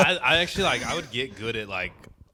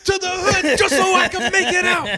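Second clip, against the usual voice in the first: high, loud, slow put-on voice; hammed-up braggart line, drawn out; mock boast; 14 dB louder, slower than usual. S0 showing off his class clown bravado in a loud put-on voice. Bit, much higher and louder.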